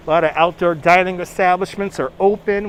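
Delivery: ordinary talk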